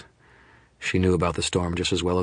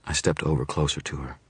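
usual speaking voice

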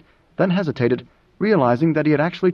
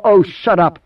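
sharp command, higher and louder; 4 semitones higher, 5 dB louder than usual. Acted. Moneo silences Idaho's threat, commanding. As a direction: cut him off with practised authority. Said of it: "had a lifetime of command behind it".

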